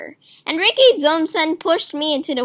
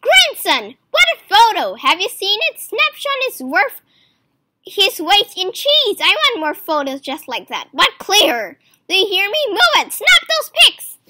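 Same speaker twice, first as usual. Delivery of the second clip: put-on higher, louder bossy old squawk; squawking grandfather; 5 semitones higher, 5 dB louder than usual. A narrator's reading. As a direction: bark orders down the phone like a demanding grandpa. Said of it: "Grandfather William's voice squawking".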